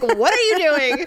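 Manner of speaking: mock loud hiss of alarm; really loud. Husband scolding her across the table, loud. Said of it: "Really loud, like".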